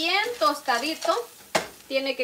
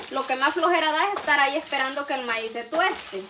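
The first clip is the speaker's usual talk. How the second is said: ordinary talk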